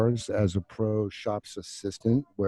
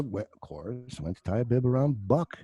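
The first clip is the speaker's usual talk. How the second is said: ordinary talk, nothing marked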